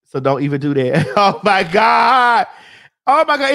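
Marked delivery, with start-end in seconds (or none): 1.2-3.5 laughing shout. Real, not acted.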